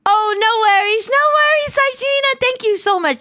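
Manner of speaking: high, gushing squeak; excited reassurance. Real, not acted. Streamer reassuring a fan who missed them, excited squeaky thanks. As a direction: gush it high, easing back at the end.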